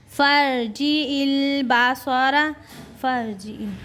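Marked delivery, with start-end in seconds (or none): 0.1-2.5 slow, high chant; Quran recitation. Shown as a demonstration. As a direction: draw out फर्जी इल Basara. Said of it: "Quran recitation demo".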